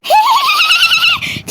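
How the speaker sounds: very high, fake giggle; forced laugh. Mother told to send her off laughing, forced laugh. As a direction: squeal out an exaggerated laugh. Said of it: "சிரிச்சிட்டு சொல்லுன்னு சொல்றான்ல".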